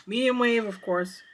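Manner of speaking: usual speaking voice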